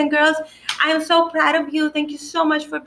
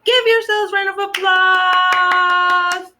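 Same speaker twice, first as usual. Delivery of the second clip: higher, louder, slow celebratory call; excited cheer; 6 semitones higher, 5 dB louder, slower than usual. Real, not acted. Teacher cheering kids at the end.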